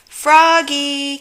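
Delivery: mother calling from afar, loud. A narrator's reading. Mother calls her son out of bed, loud. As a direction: call him like a mom down the hall. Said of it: "called his mother".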